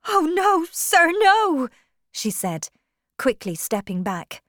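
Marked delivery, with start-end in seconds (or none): 0.1-1.7 high, loud, distressed woman; alarmed refusal. Acted. Young woman refusing a marriage proposal, alarmed. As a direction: recoil with a high, raised cry.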